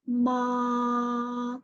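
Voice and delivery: long 'ma' held level; high flat tone. Shown as a demonstration. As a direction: sustain 'ma' on one unwavering pitch. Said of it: "It's a high and flat tone".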